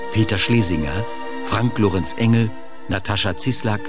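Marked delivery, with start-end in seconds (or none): none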